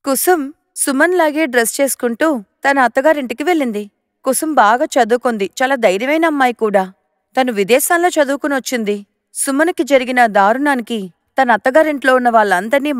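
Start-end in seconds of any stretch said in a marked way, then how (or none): none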